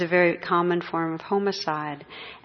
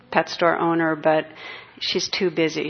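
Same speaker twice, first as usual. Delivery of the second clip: ordinary talk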